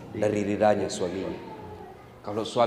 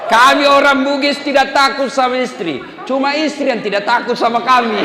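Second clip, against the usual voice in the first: boastful brag; 13 semitones higher, 8 dB louder than usual. Acted. Boastful joke about Bugis men.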